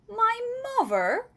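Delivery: high, loud astonished cry; cried out. A narrator's reading. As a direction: burst out in disbelief. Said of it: "cried the B F G".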